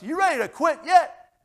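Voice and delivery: high, loud mocking drill-sergeant yell; yelling devil. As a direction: bark the taunt to break them. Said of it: "somebody yelling at us".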